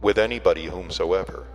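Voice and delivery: ordinary delivery, nothing marked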